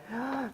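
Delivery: quick gasp of sudden inspiration; soft, acted intake of breath; mock inspired gasp. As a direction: gasp softly as if ideas just struck. Acts out his moment of inspiration.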